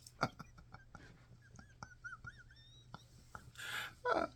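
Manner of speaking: long, high wheezy laugh; real helpless laughing fit. Real, not acted. Laughing at God's jokes.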